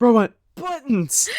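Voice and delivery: high incredulous yelp; surprised. Real, not acted. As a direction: shout it in disbelief. GM reacts to button discovery.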